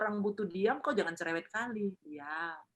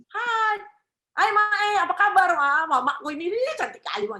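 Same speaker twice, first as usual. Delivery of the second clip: much higher and louder, bubbly hello; cheerful greeting; 10 semitones higher, 9 dB louder than usual. Shown as a demonstration. Child greeting mother cheerfully, high and loud. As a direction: greet mom with bright delight. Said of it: "orang lihat kamu itu orang yang".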